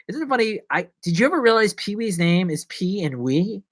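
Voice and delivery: ordinary talk, nothing marked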